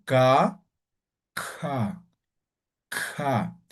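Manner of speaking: "ka" with a breathy puff after the k; aspirated ka. Shown as a demonstration. Teacher showing the class the aspirated K, a breathy "ka". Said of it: "Y la K".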